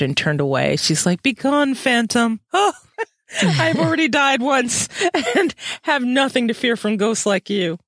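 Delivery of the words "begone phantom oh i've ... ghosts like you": higher, half-crying frightened woman; frightened Louisa. Retold or imitated. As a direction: quaver it out like a scared ghost-haunted girl. Louisa voiced in read-aloud scene.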